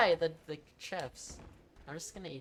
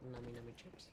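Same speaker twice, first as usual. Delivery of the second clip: tasty yum mmm; 5 semitones lower, 15 dB quieter than usual. Pretend. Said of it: "you're trying to reverse psychology me".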